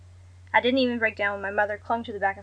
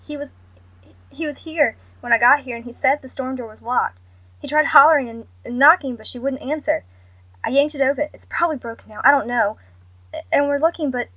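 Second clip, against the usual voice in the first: frantic, loud, higher words tumbling out; panicked rushing; 4 semitones higher, 6 dB louder than usual. A narrator's reading. Mother on the phone explaining in a frightened rush. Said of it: "tried to rush the explanation along".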